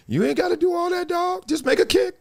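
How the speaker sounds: very high pleading voice; exasperated plea. Acted. Host to kicker overthinking it, high-pitched exasperation. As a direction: squeal it softly in disbelief.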